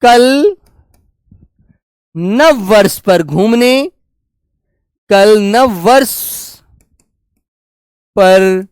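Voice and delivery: very slow, phrase by phrase, repeating as he writes; slow dictation. Shown as a demonstration. Teacher writing the second example sentence, slow dictating. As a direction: dictate it piece by piece to the class. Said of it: "दूसरा वाक्य मैं लिखता हूं आपके सामने".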